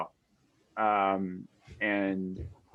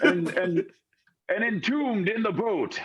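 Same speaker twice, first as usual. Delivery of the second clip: higher, mildly theatrical proclamation; lightly voiced ghost with a grand air; ghostly boat souls; 8 semitones higher than usual. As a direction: declaim it with a touch of doom.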